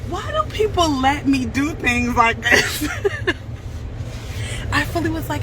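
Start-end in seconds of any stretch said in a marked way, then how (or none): 0.1-2.9 high, drawn-out plea breaking into laughter; amused exasperation, stretched out and pitched high; laughing disbelief. Real, not acted. Laughing at own impulsive choice. 2.9-4.7 high, airy laugh; wheezy high-pitched giggling fit; helpless laughter. Real, not acted. Cracks up at herself.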